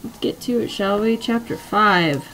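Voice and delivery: ordinary delivery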